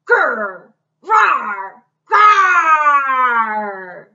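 loud growl then long roars; bear roaring. Acted. Bear shows Taylor how to shout, loud roars.

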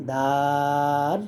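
দাল with its vowel held very long and even; held four alif long. Shown as a demonstration. Teacher demonstrating a letter name to beginners, long and held. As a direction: sing out দাল, long and steady. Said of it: "দাল চার আলিফ".

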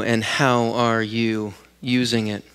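usual speaking voice

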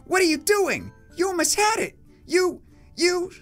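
high-pitched protest; indignant boy. Acted. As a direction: sputter in a high kid voice. Boy protests stopped battle.

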